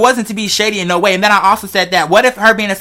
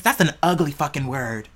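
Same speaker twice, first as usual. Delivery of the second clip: ordinary talk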